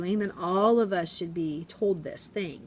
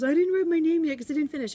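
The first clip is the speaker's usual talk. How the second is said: put-on high, worried kid voice explaining himself; a child fretting about unfinished work; flustered little boy; 9 semitones higher, 3 dB louder, faster than usual. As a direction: play the upset first grader, voice pitched up. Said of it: "He goes".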